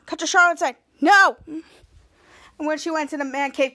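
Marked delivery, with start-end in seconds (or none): 1.0-1.4 firm refusal. Retold or imitated. Charlotte refuses flatly.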